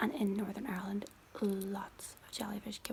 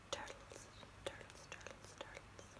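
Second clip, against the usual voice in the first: fading whispered echo; 15 dB quieter than usual. Pretend. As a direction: repeat the word, fading away each time. ASMR fading echo repetition.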